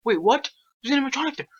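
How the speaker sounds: high panicked yelp; sudden alarm at the monster; scared horror game streamer. Real, not acted. Animatronic appears in horror game.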